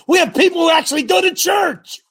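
high, loud, emphatic call; excited shout. Real, not acted. Coach cutting in to Glenn with a loud excited shout. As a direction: shout it over the room.

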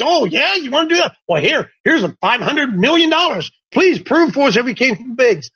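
high, gushing mock enthusiasm; mock eager funder. Preacher mocking funders of evolution research, high gushing voice. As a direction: hand over the money with exaggerated delight.

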